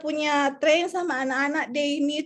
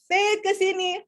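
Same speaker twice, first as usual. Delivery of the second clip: raised call; 6 semitones higher than usual. Retold or imitated. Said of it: "Itu baru nada do".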